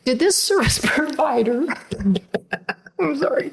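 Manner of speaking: high, catching voice broken by laughs; choked up, not crying. Real, not acted. Mother recalls her son's awful job interview, choked up. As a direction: hold back tears through laughter. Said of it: "I'm not, I'm not crying".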